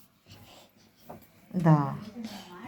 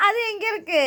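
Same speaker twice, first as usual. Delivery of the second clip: asked through a laugh, much higher; 11 semitones higher than usual. Real, not acted. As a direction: still laughing, ask where it is. Laughing while asking child.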